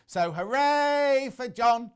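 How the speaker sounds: high, drawn-out hooray; mock cheer. Presenter reading a viewer's cheer for himself, hammed-up cheer. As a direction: cheer slowly, stretching the hooray.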